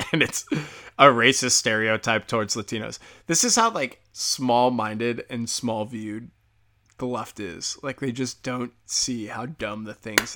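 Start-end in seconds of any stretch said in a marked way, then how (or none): none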